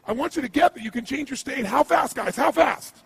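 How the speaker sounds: high, loud, driving seminar call; rally the room, pushing every word; preaching at full force. Acted. Coach to seminar crowd: loud, high motivational preaching.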